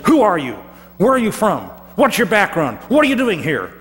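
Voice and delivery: high, loud rapid interrogation; demanding sailors. Acted. The sailors loudly grill Jonah about who he is. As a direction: fire questions at the stranger.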